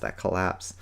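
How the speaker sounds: usual speaking voice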